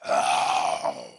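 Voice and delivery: a low growling roar, played for the congregation; mock lion roar. Preacher roaring like a lion at the sheep, mock roar.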